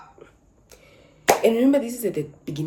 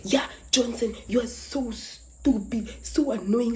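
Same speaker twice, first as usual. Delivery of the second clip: a mock angry rant, higher; angry scolding; 7 semitones higher, slower than usual. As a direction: scold him, mildly hammed-up. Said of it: "she walked in so aggressively".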